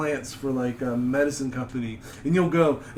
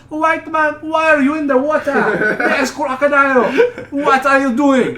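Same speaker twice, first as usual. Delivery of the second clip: much higher and louder, laughing calls from the riverbank; shouting villagers; 11 semitones higher, 9 dB louder, slower than usual. Retold or imitated. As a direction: yell a warning from the shore, half laughing.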